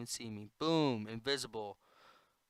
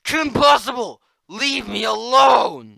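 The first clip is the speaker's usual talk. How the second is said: furious scream; shouting; 11 semitones higher, 20 dB louder than usual. Acted. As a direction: scream at her to go away. Screams at character.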